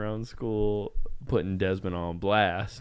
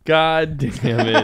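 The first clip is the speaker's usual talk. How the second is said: loud, drawn-out curse, raised in pitch; exasperated shout; 5 semitones higher, 8 dB louder, much slower than usual. Real, not acted. Host stuck bleeping his co-host's words, loudly exasperated.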